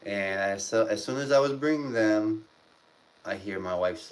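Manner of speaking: ordinary talk, nothing marked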